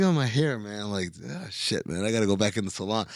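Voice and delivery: soft, offhand fretting about hair; nonchalant. Retold or imitated. Mom checking herself in the mirror, nonchalant. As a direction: toss it off quietly, unbothered. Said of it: "Like, very nonchalant".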